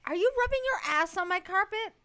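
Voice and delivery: high, loud, incredulous question; loud scolding. Real, not acted. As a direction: call out the dog in disbelief. Scolding the dog on the carpet.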